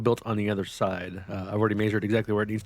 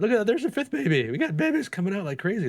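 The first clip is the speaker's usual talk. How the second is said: higher, thrilled; excited; 9 semitones higher than usual. Real, not acted. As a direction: cheer the stream of babies. More babies appear.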